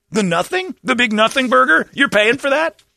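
mock outrage at being billed for nothing; incredulous. Real, not acted. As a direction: push each question up in disbelief. Ranting at a bill for nothing.

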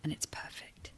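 hushed mock-affirmation whisper. To friends, a mock self-care whisper. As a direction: breathe it like a self-care mantra.

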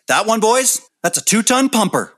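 high, loud, cocksure dad voice; mock-authoritative dad pronouncement; bandmate's dad. Imitating bandmate's dad in story.

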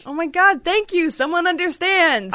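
loud, high cry of relief; exasperated relief. Real, not acted. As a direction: cry out as if finally understood. Exasperated relief at being understood.

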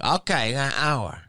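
a hint of the maid's high voice; maid agreeing. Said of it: "And she's like".

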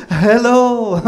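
hammed-up Korean accent greeting. Wife greeting the hosts, in a put-on Korean accent. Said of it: "pours it on so thick".

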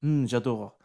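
soft French mm of pleasure; French Bercule. Acted. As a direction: murmur it contentedly, French. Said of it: "Bercule says".

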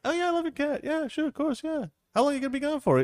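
high-pitched, agreeable friend voice; eager agreement. Acted. Friend agrees to look after the couple's cat, eager.

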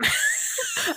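high squealing laugh; real high-pitched giggle; burst of laughter. Real, not acted. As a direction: crack up at yourself. Laughs after spitting on mic.

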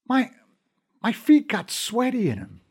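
stammering. A narrator's reading. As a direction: stumble over the first word in fear. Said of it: "he stammered".

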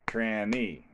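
slow two-beat count; clapped syllables. Shown as a demonstration. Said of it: "let's do the clapping".

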